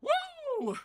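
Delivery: high, long whooping cheer; excited whoop. Real, not acted. Streamer cheering while dancing, high whoop. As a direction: let out a joyful woo.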